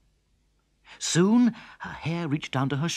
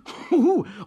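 farmer's hearty laugh; 10 semitones higher, 4 dB louder than usual. Acted.

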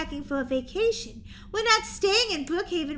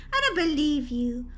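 usual speaking voice

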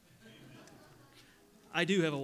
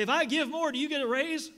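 high, loud mock question from a congregant; imitated church member; 8 semitones higher, 8 dB louder than usual. Pastor mocking a member asking about his raise, high and loud. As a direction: play the suspicious giver, pitched up.

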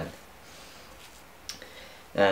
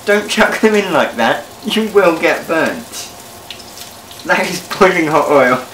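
louder, higher urgent caution; loud alarmed warning; 8 semitones higher, 8 dB louder than usual. Real, not acted. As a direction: shout a warning over the spitting pan. Oil splashing, warning viewers.

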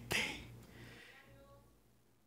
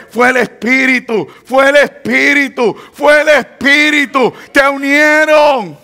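building fervour; 6 semitones higher, 5 dB louder than usual. Acted. Preacher repeats, building to climax.

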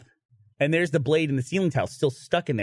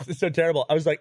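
ordinary talk, nothing marked

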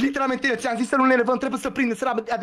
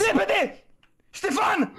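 high-pitched call to a teammate; urgent calling out; 9 semitones higher, much slower than usual. Real, not acted. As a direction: call out urgently for a friend to hurry.

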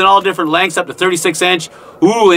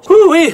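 woo-wee; 8 semitones higher, 4 dB louder than usual. Real, not acted. Exclaiming after digging.

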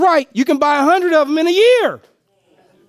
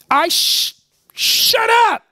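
playful shut up; 8 semitones higher, much slower than usual. Real, not acted. Playful aside to audience.

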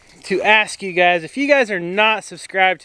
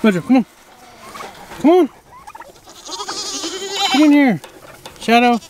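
calling out; 7 semitones higher, faster than usual. Real, not acted. Calling escaped animals back in.

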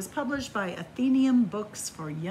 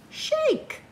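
a sudden high squeaky shake; high squeak; 3 dB louder than usual. Acted. Reader voicing the command in a high squeak. Reading picture-book commands.

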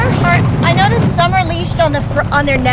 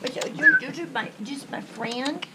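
soft cooing; 4 semitones lower, 14 dB quieter than usual. Real, not acted. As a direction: murmur tenderly to the dog. Quiet cooing to dog.

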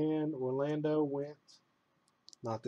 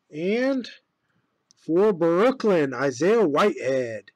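announcer voice; 7 dB louder, slower than usual. Acted. Announces card pull like a broadcaster.

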